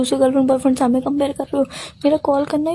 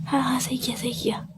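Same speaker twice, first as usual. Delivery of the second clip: whisper; 4 semitones lower, 9 dB quieter than usual. Real, not acted. Whispering back on a secret call.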